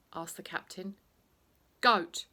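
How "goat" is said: GOAT called out big and loud; loud proud announcement. Acted. Teacher proudly names himself the goat, loud. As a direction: boom out the word goat.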